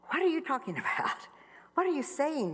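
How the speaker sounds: retelling her shocked reply to God; shocked disbelief. Retold or imitated. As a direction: react in stunned disbelief. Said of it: "And I was like".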